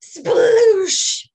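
high, loud sploosh effect; big splash sound. Acted. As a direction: shout the splash like a picture book. Horse falls into water.

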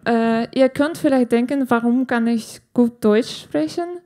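normal talking voice

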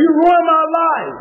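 a woman yelling through tears at the dance master; crying and yelling. Retold or imitated. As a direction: shout it out like your life was stolen. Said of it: "she started crying and started yelling".

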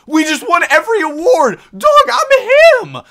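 high, loud victory shout; real hype after winning big; triumphant yell. Real, not acted. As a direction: brag at full volume, thrilled. Team sweeps every award.

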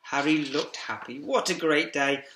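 ordinary delivery, nothing marked

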